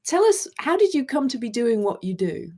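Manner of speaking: ordinary talk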